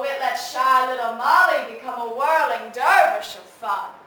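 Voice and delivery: loud, whirling exuberance; exuberant boast. Acted. Reporter recalling what drink gave her, exuberant boast. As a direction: build it big with gleeful relish. Exulting over what alcohol did.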